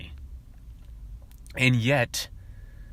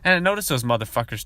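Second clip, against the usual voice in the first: ordinary talk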